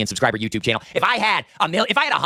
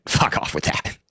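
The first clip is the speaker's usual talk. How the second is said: breathy whisper, much lower; whispered fuck off; whispered brush-off; 10 semitones lower, slower than usual. Real, not acted. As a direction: hiss it under your breath to dismiss. Muttered dismissal.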